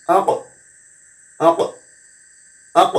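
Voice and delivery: আক ending in a short echoing ক release; bounced qalqalah. Shown as a demonstration. Teacher repeating the qalqalah drill, bounced ক. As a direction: pop the ক of আক lightly. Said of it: "ওরকম টেম দিতে হবে".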